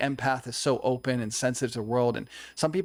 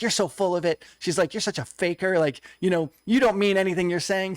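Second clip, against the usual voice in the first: imitating an ex's jabbing accusation, a bit higher and louder; mock angry accusation; 5 semitones higher, 4 dB louder than usual. Ex-girlfriend jeering at him mid-prayer, retold with mild mock anger. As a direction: throw her accusing words back, half-acted. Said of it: "She was angry".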